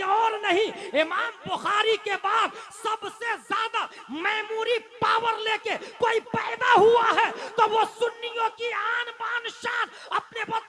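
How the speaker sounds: high, very loud oratory; shouted sermon climax; full-force preaching. Real, not acted. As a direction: proclaim it to a huge crowd, building to acclaim.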